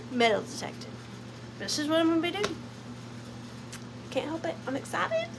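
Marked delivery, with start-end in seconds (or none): 0.1-0.9 deadpan answer. Acted. Deadpan answer to imagined searcher.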